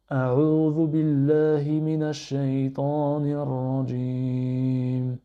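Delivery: careful Arabic recitation at even pace. Shown as a demonstration. Teacher repeating the phrase for learners, clear recitation. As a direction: recite it clearly for students to copy. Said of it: "Je répète".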